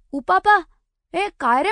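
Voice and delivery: loud, high call across the way. Acted. Girl calls out loudly to Upapa from a distance. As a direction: shout his name to catch his attention.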